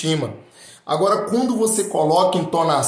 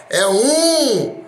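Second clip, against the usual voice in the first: drawn-out count, rising higher and louder on dois; mock countdown; 6 semitones higher, 4 dB louder, much slower than usual. Skydiving instructor tricking Will Smith, hammed-up countdown. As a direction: count slowly, then jump early with a burst. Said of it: "ele simplesmente chegou assim".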